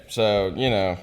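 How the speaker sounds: ordinary delivery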